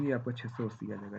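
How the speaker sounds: usual speaking voice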